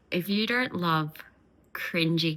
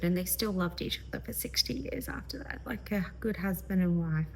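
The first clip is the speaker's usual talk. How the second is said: hushed, unvoiced aside; whisper; 6 dB quieter than usual. Real, not acted. Vlogger whispering to viewers about the old couple. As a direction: whisper it like a tender secret.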